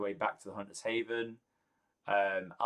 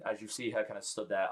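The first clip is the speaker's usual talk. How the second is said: usual speaking voice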